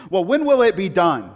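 impatient lady. Retold or imitated. A Costco shopper demanding the sample, impatient and loud. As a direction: push for it impatiently. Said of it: "she goes".

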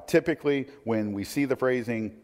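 ordinary delivery

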